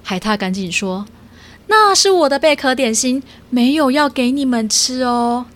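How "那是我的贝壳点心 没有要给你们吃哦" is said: higher and louder, guarding his snacks; snatch the snacks away with your voice; protective otter. Acted. Otter telling the cats his shell snacks are off limits, protective.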